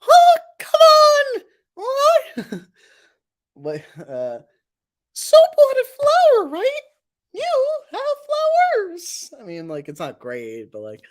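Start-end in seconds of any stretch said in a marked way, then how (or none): 0.1-2.6 very high, shouted cartoon whine; Balloon. Streamer doing a Balloon impression, high shouted voice. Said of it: "I do an okay balloon". 5.1-9.3 very high, loud squeaky voice; Balloon. Acted. Streamer trying a passable Balloon, high squeaky voice.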